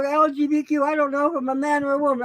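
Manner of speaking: high, fast mocking whine; high, fast parody of a confused person. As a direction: sneer at them in a high, quick voice.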